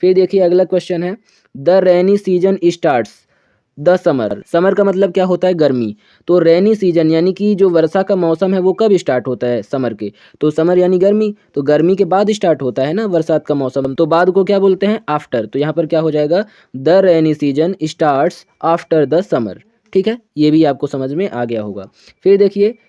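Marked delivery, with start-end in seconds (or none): 1.6-4.5 slow dictation. Shown as a demonstration. Reading exercise sentence with blank. 16.8-19.6 slow dictation. Shown as a demonstration. Reading completed sentence slowly.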